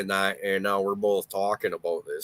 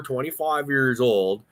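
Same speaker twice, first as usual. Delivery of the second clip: ordinary talk, nothing marked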